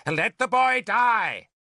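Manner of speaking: high, drawn-out shout. A narrator's reading. Merlin calling down to Arthur from the ladder, shouted. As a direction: shout slowly from above. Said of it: "Merlin shouted from the ladder".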